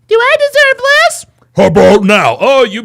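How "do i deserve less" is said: high, louder mocking falsetto; squeaky parody of the caller. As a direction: parody her question in falsetto.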